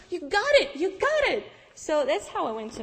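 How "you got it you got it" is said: much higher and louder, overjoyed; excited cheer. Retold or imitated. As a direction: shout it out like great news. Friend celebrates the visa news.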